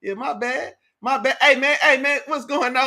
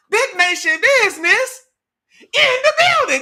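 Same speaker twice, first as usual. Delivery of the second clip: high, loud hype call to a viewer; hype shout; 11 semitones higher, 8 dB louder than usual. Real, not acted. Streamer shouting out a viewer arriving in chat, hype call. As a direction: announce her like a ring announcer.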